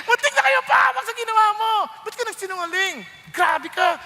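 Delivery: high, loud hammed-up scolding; mock scolding. Pharaoh berating Abraham for lying, mock outrage. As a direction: scold him like an outraged king, half in jest.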